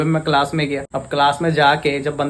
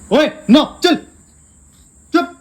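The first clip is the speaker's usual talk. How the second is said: sharp, much higher, louder scold; scolding the dog; 7 dB louder than usual. Real, not acted. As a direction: scold the puppy firmly. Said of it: "मैं डांटूंगा ना".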